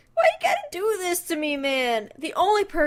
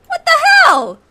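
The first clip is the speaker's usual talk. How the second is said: high, loud what the hell; exasperated shout; angry shout; 14 semitones higher, 9 dB louder, faster than usual. Real, not acted. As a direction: yell it at the screen. Streamer yelling at game character.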